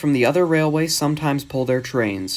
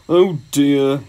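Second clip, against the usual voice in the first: louder, mildly woeful sigh; sad; 2 semitones higher, 5 dB louder than usual. A narrator's reading. Gordon failing to reach London, understated sadness. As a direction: lament it in an engine voice. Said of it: "he thought sadly".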